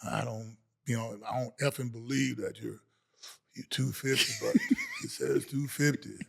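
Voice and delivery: low, quiet grumble of disbelief; skeptical smoking coach. Retold or imitated. Coach looking over an undersized player, low and skeptical. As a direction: imitate a doubting coach, low and subdued.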